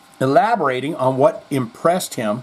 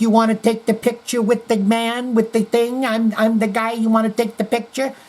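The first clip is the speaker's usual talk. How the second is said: higher, brash street-hustler pitch; Andrew Dice Clay; 8 semitones higher than usual. Retold or imitated. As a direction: hustle strangers like a cocky comic. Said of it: "he's like".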